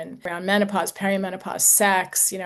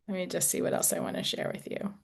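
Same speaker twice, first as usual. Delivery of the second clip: quick whispered note to herself; under her breath, checking what's next; whispered aside; 10 dB quieter, faster than usual. Real, not acted. Aside to self while checking notes.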